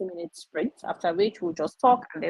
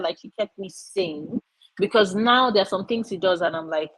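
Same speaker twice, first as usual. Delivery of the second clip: ordinary delivery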